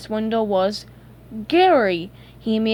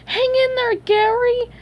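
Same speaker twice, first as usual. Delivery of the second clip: high, urgent call; 4 dB louder than usual. A narrator's reading. SpongeBob to Gary through the window, calling out. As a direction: call encouragement from afar. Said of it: "SpongeBob called".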